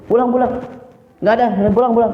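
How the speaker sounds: high-pitched, curt retold dismissal; mock angry intonation. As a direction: send him off in imitated annoyance. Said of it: "terdengar seperti intonasi marah". (menirukan nada marah, mengusir)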